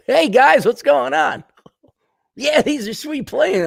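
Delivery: chirpy, eager mock Canadian; cheery Canadian pilot. Comic voicing a Canadian pilot joining the fight, cheery and high. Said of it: "get a Canadian in there".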